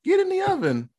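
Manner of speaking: high mock-sweet old lady; hammed-up witchy grandma order. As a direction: pitch it way up like a creepy granny. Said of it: "If my grandma was like".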